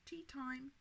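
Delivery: plain reading voice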